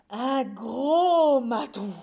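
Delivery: huge slow bellow, GROS stretched out; startled shout. Acted. Mice suddenly spotting the cat, long loud shout. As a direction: roar the big reveal.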